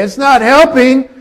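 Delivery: half-joking shout through the window; frustrated yell out the window. Retold or imitated. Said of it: "roll the window down and say".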